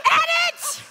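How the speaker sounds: high, loud, laughing yelp; laughing shout. Real, not acted. Pastor calls for an edit after a gaffe, laughing shout. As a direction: yell it through laughter.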